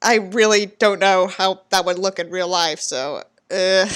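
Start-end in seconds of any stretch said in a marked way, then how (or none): none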